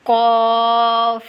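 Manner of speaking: قاف with a thick back q, held long; thick, drawn-out قاف; heavy qaf. Shown as a demonstration. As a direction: sustain قاف with a full, heavy vowel. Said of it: "ایک کو ہم موٹا کر کے پڑھیں گے".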